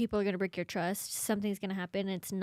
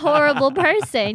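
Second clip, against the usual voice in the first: mock betrayal; 6 semitones higher, 12 dB louder than usual. Child's mock-betrayed reaction, retold.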